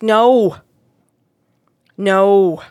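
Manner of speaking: loud, emphatic refusal; wife's voice, loud and firm. Retold or imitated. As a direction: say no like you mean it, twice. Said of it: "his wife is like".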